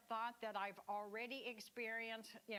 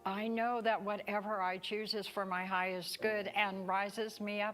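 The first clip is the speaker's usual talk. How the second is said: prayer; 10 dB louder than usual. Acted.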